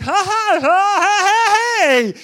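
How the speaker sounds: loud, high-pitched dopey laugh; hammed-up idiot guffaw swelling into a "hey"; stupid laughing. Preacher mocking someone walking around stupid, loud dopey laughing. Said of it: "I'm not walking around stupid".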